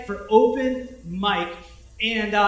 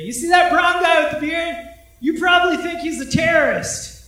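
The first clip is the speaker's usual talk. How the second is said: high, loud taunting call; imitating a stand-up heckling the crowd; mock heckler shout; 7 semitones higher, 7 dB louder than usual. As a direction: shout it across the room to single him out. Heckler repeats taunt from stage.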